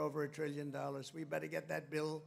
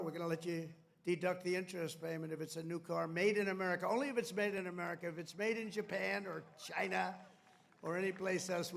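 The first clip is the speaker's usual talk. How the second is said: ordinary talk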